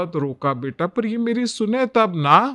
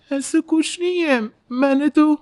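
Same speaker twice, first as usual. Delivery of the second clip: higher, pleading denial; hurt protest; 7 semitones higher than usual. Acted. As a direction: try to defend yourself. Bride denies the accusation. (बहू की दुखी, ऊंची सफाई)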